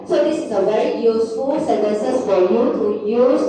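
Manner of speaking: ordinary talk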